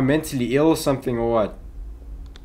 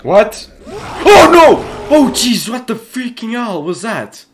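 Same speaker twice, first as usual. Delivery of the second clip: very high, loud scream of fright; terrified shout; 9 semitones higher, 9 dB louder, slower than usual. Real, not acted. Gamer startled by something in the dark, screaming. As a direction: scream out, genuinely scared. Jump scare in horror game.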